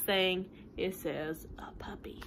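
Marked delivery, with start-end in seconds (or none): 1.6-2.2 whispered reveal; hushed storytime reveal. Acted. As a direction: breathe the last word like a secret. Secret last item on list.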